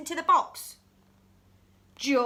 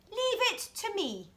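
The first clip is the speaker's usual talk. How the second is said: much higher, breezy; girl doctor taking charge, higher; higher confident Sophie; 8 semitones higher than usual. Acted. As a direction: take charge cheerfully.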